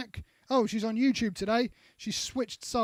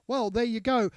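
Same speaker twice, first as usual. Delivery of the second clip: ordinary talk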